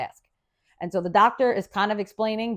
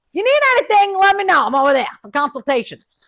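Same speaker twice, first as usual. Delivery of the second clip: chirpy offer; 9 semitones higher, 7 dB louder than usual. Retold or imitated. Offering help to roommate.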